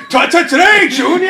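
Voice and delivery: high, loud stuttering shout; a flustered kid tripping over the word. Retold or imitated. Stuttering kid calling out to Junior, high loud shout. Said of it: "I'm like that kid".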